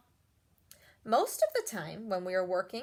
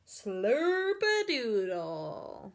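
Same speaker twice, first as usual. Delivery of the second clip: ordinary delivery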